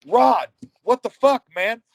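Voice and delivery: high, loud, drawn-out disbelief; retold exasperated protest. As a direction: act out protesting to an opponent mid-match. Retold protest at opponent in hold.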